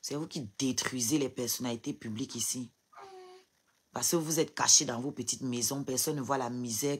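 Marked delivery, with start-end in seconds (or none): none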